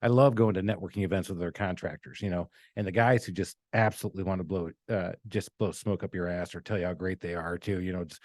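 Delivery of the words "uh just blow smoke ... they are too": ordinary talk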